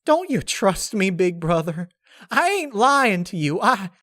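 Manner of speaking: high, pleading voice near tears; tearing up. Acted. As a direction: fight back tears as you beg. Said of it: "begin to tear up".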